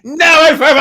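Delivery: high, loud mock-dramatic shout; shouted spell. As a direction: belt it out like the climax of a spell. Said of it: "it's like".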